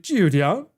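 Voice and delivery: "Julian" with the pitch dipping and swinging back up; down-then-up tone. Shown as a demonstration. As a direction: drop the voice on "Julian", then lift it. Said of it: "start the pitch going down and up".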